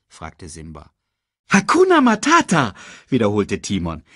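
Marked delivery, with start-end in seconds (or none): 1.5-2.7 high, loud proclaimed motto; upbeat meerkat. Acted. Meerkat repeating the motto to cub, high proclamation.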